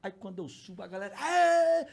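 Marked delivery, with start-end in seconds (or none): 1.1-1.9 high, loud roar of a crowd; imitated crowd cheer. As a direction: cheer like a whole stadium. (imitando a galera vibrando)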